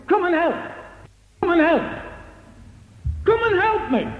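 high shouted plea to come and help; rising shouted demand; shouting demand. Retold or imitated. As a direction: yell at the lazy men. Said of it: "she gives them some salt".

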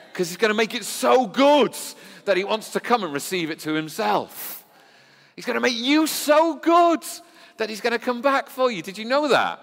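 soaring high and loud, preaching hard; full-force preaching. Real, not acted. Preacher urging the congregation, high and loud. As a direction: lift it up and drive it home to the church.

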